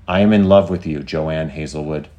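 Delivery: loud, deliberate declaration easing on the name; AI declaring love. Acted. AI to the woman it loves, deliberate and firm. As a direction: declare it solemnly, slowing at the end.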